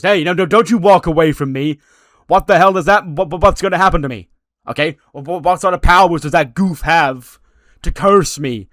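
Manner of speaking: loud, high, frantic shouting; furious yelling. Retold or imitated. Johnny shouting at the fleeing Danhausen, loud and frantic. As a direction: yell after him, flustered.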